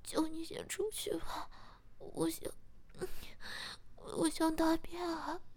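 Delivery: plead, stretching the last words; embarrassed pleading. Acted. Captive begs, embarrassed.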